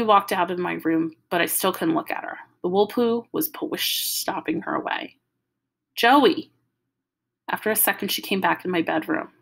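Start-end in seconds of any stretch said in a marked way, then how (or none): 5.9-6.5 calling out. Acted. Calling sister back.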